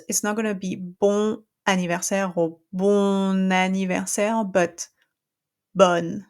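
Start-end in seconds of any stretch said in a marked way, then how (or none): 5.7-6.2 bon with a wide open O and a clear N. Shown as a demonstration. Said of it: "open that O, it's the big O".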